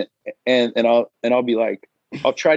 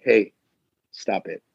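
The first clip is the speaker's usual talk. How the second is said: low, firm self-scolding; stern low voice in your head; inner voice of reason; 7 semitones lower than usual. Acted. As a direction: talk yourself down in a lower voice. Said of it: "have that voice in your head that's like".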